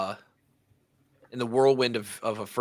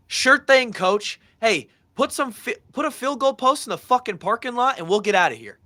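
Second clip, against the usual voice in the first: much higher, louder mocking sass; sarcastic comeback; 11 semitones higher, 6 dB louder than usual. [name] mouthing off to coach Parker, high mocking sass. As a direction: toss it back at the coach with cheeky sarcasm.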